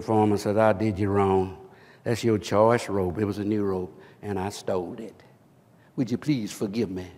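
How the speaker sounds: very low, hushed plea; imitated tearful confession. Thief confessing to the man he robbed, mock-contrite. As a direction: retell a penitent's plea, low and subdued. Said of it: "And he's crying".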